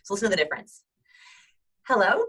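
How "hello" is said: high, loud, rising hello; tense, high-pitched greeting. Shown as a demonstration. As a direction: say it from the top of your breath, pitched high. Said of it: "I go really high".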